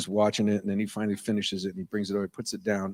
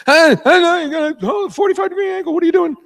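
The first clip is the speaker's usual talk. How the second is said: much higher and louder, outraged; high, loud mock outrage at a bad pour; high-pitched yell; 10 dB louder than usual. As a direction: shriek at the barmaid in mock horror. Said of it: "I'm like".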